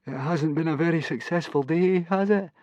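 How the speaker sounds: high-pitched plaintive moan; Fishlegs moaning. A narrator's reading. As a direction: moan it in a high voice, rising at the end. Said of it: "moaned Fishlegs".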